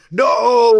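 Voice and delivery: high, loud cry of dismay; shocked no. Retold or imitated. Alone in the apartment at the finale, a high loud no. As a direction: cry it out at the screen. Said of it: "I literally just went".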